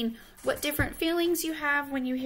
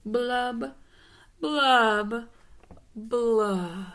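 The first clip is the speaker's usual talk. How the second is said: drawn-out "blub", sinking in pitch; three long mopey fish bubbles; glum fish blubs; 6 dB louder, much slower than usual. Acted. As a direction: blub slowly like a pouting fish. Pouting fish's drawn-out blub.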